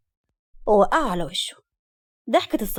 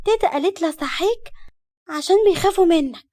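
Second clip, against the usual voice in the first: put-on high-pitched small child; little girl voice; 8 semitones higher than usual. A narrator's reading. Small daughter waking her father, childlike. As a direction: report it innocently in a high child voice.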